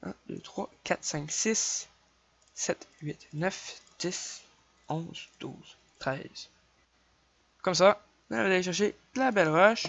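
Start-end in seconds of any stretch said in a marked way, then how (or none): 0.0-6.5 counting under breath. Real, not acted.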